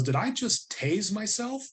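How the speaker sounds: high, slow puzzled voice; bewildered question. Retold or imitated. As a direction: ask it like you can't believe it. Retold confused question.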